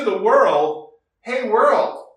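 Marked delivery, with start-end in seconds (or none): 1.2-2.1 calling out. Pretend. Israel calling out to nations.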